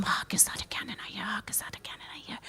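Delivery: rapid, barely audible whisper; quiet rehearsal. Acted. Actor practising while putting on his costume, whispering. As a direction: whisper the line over and over, quickly. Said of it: "He has to be quiet".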